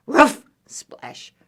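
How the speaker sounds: short high ruffs with splashes; yappy, high-pitched ruffs; dog bark. Acted. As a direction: bark like a small dog in water. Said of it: "And dog says".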